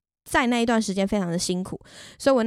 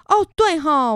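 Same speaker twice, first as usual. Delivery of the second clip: sudden realization; 7 semitones higher, 6 dB louder, slower than usual. Retold or imitated. Suddenly remembering own mistake.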